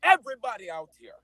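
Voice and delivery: loud, high, laughing Jamaican boast; laughing Jamaican bragging; Jamaican accent. Acted.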